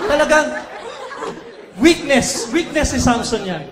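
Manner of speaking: loud, laughing punch on weakness; laughing emphasis. Real, not acted. Preacher teasing Samson's weakness to the crowd, laughing loudly. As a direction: laugh through it while stressing.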